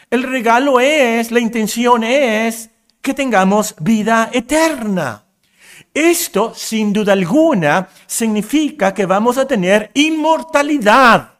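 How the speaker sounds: impassioned pulpit delivery; full-force preaching. Acted. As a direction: preach it out, rising with conviction.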